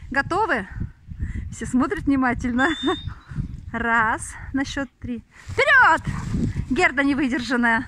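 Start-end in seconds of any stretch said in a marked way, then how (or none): 5.5-6.0 high, loud call to run; shouted command. Real, not acted. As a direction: launch the dogs with a shout. Sends the dogs running.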